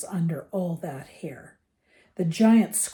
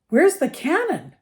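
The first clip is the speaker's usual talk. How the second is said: high, louder dim-witted question; dazed and stupid; 9 semitones higher, 5 dB louder than usual. A narrator's reading. Dudley jerks awake and asks about the noise, stupidly. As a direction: blurt it out like a dopey boy. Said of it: "he asked stupidly".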